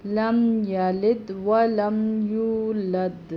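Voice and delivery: لم یلد ولم یولد recited slowly in one breath; joined recitation. Shown as a demonstration. Teacher showing how to join the verse, slow recitation. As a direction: chant it without stopping between words. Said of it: "یہاں ہم بھی میں لم یلد نہیں پڑھ سکتے".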